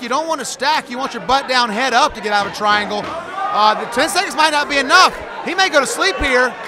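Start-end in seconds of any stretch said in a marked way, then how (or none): 3.8-6.5 excited commentary. Real, not acted. Submission about to finish round.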